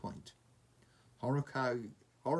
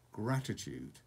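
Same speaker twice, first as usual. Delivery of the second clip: ordinary delivery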